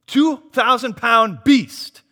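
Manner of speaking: usual speaking voice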